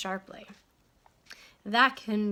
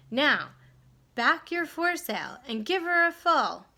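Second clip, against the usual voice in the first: loud, high shouted order; screaming; 6 semitones higher, 4 dB louder than usual. Acted. Owner screaming sailing orders to Stuart, loud. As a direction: scream the instruction urgently. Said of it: "screamed the owner of the Wasp".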